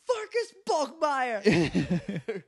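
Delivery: high, loud, slow full-name scolding; angry mom voice. Retold or imitated. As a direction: call him out like a furious mom. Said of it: "I'm surprised she wasn't like".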